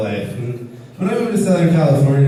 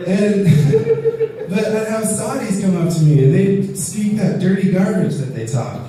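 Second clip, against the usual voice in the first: usual speaking voice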